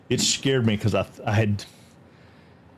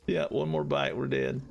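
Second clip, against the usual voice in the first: ordinary talk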